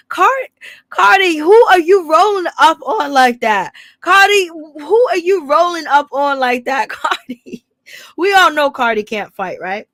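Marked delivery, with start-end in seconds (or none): 0.1-7.6 loud, high-pitched mock confrontation; mock challenge. Host calling out [name] for rolling up, mock-challenging. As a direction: call her out, louder and higher, playfully incredulous.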